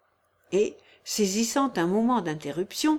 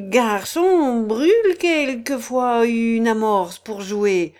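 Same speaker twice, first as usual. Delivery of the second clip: mocking retort; 6 dB louder than usual. Curly gentleman's mocking retort.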